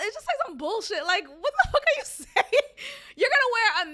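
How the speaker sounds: high, laughing disbelief; incredulous outburst. Real, not acted. Host scoffing at slogans on masks, incredulous.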